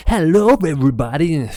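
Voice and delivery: high, loud over-the-top YouTuber greeting; wacky YouTuber voice. Shown as a demonstration. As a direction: burst in like a hyped YouTuber. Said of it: "a wacky YouTuber voice".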